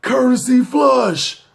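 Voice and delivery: high, drawn-out shout across a cell; acted yell of complaint; hollered demand. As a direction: holler it slowly so everyone hears. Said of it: "all you hear is".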